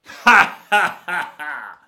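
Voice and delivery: loud, high evil laugh; villainous burst of laughter. A narrator's reading. Bandit laughing over his doomed captive, loud evil cackle. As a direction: roar out a stagey villain's laugh. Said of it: "Uno scoppio di risa".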